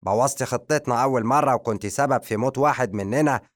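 stern. Acted. The magician throwing Madiha out, loud stern rebuke. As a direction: cut her off and tear into her. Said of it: "قاطعها الساحر بصرامة".

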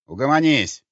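громкий приказ повыше; осади подчинённого; строгий окрик. Acted. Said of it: "снова строгий голос в темноте". (строго и властно)

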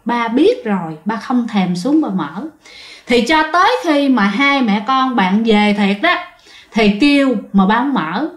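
usual speaking voice

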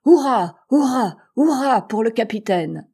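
loud, high hurrahs; crowd cheer. A narrator's reading. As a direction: cheer the captain with force. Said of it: "s'écria d'une seule voix".

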